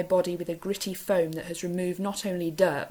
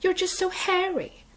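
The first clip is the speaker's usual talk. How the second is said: much higher piping Capitol voice; high fluting Capitol accent, rising at the end; silly Capitol accent; 13 semitones higher, 3 dB louder than usual. A narrator's reading. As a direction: trill it in the airy Capitol accent. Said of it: "she pipes in her silly capital accent".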